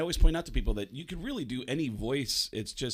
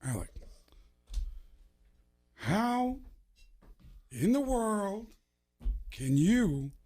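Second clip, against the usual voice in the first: Al Pacino impression; 8 semitones higher than usual. Pacino telling Baldwin he's miserable, in a Pacino impression. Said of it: "Pacino looked at him and said".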